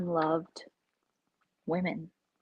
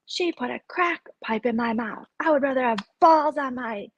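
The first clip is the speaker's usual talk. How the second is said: mocking the hags; 5 semitones higher, 4 dB louder than usual. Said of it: "they're like".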